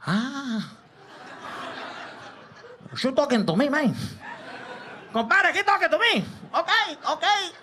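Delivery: channel Scarface confronting the ref. Retold or imitated.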